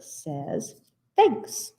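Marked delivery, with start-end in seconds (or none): 1.1-1.7 bear's thanks. Acted. Bear character voice in story.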